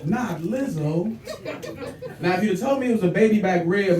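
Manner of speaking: ordinary delivery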